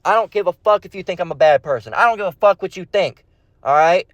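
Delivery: ordinary talk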